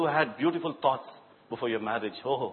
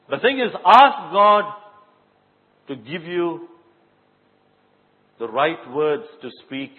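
preaching; 3 semitones higher, 9 dB louder, slower than usual. Acted. Preaching emphasis.

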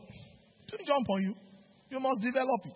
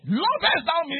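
shouted plea; 6 semitones higher, 4 dB louder than usual. Acted. Jesus voiced again, shouting.

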